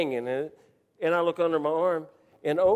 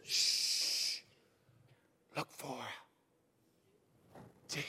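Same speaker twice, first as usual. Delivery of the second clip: long shush, then slow whispered words; whispering; 6 semitones lower, 13 dB quieter, slower than usual. Retold or imitated. Buddy shushes his friend to hunt on, whispering. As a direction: hush him, then whisper with long pauses. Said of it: "He's whispering".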